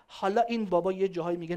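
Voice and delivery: usual speaking voice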